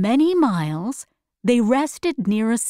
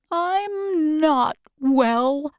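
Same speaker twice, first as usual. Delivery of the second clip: downcast; 5 semitones higher, much slower than usual. Acted. Scarecrow answers that he's unwell.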